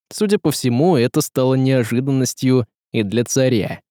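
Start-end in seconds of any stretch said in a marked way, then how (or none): none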